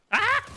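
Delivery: sharp high yelp; a sudden scared cry; jump-scare yelp; startled yelp. Real, not acted. Sudden enemy.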